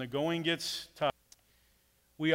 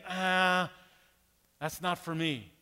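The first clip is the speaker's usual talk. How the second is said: reluctant excuse; 3 semitones higher, slower than usual. Retold or imitated. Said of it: "we drag our feet and say".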